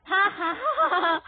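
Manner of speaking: high, bursting cackle; loud laugh. Real, not acted. Player laughing at a character, loud laugh. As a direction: laugh out loud, climbing in pitch.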